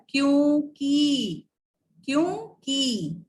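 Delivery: "क्योंकि" slow and stretched, "kyoon-kee"; careful demonstration. Shown as a demonstration. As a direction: draw out both syllables of "क्योंकि". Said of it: "बहुत गलत लिखते हैं".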